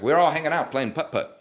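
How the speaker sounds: [name] impression. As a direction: toss it off like a casual anecdote. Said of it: "these voice impressions are spot on".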